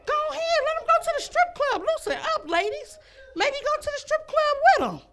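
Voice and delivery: mock advice. Mock-advising ladies.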